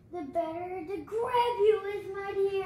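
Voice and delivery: loud, slow growl of a line; the wolf grabbing Little Red, a loud slow roar; menacing wolf roar. Acted. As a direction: pounce on the words as the wolf attacks. Wolf reveals himself, lunging.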